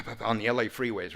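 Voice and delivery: usual speaking voice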